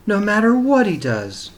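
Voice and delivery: mother's vow. Acted. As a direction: cry it out as the heartbroken mother. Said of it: "said Missus Duncan".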